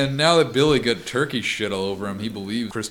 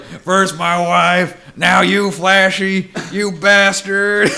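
slow, much louder and higher hammed-up cry of vengeance; mock vengeful scream; 9 semitones higher, 9 dB louder, much slower than usual. Oscar cursing the turkey who killed his dog, hammed-up slow yell. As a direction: bellow it at the heavens like a B-movie hero. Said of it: "And he's like".

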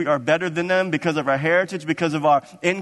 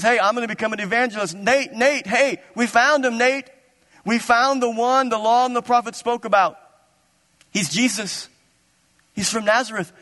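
high, eager calling out to a friend; excited news-bringer; 8 semitones higher than usual. Acted. Philip tells Nathanael they found the one, excited. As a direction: burst out with thrilling news, pitched up.

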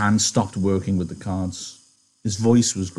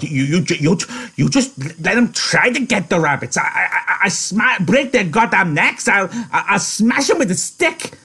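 put-on much higher, stammering, childlike fury; threatening; 9 semitones higher, 3 dB louder than usual. Lenny threatening the cats that might take the rabbits, angry. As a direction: sputter threats like an angry big kid. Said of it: "grumbling to himself, threatening".

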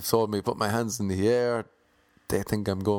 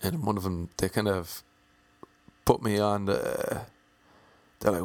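normal talking voice

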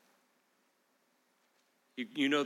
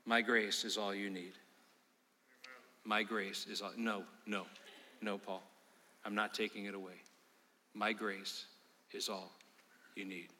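gentle answer; 4 semitones lower, 7 dB quieter than usual. Retold or imitated. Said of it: "And each time he said".

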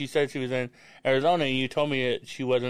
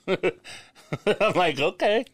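laughing; 7 semitones higher, much slower than usual. Real, not acted. Laughing while quoting.

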